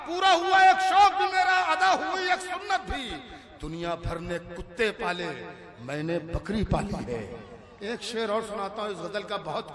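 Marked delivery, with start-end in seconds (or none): 0.1-3.0 high, loud mushaira recital; declaimed poetry. Acted. Recites verse to audience. 3.6-7.2 low-pitched, easy recitation; relaxed verse recital. Acted. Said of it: "किसी शेर को आराम से पढ़ूंगा".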